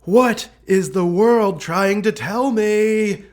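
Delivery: retelling an exasperated cry at fate; exasperated self-quote. Retold or imitated. To herself about losing it again, exasperated and pleading. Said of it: "So I'm like".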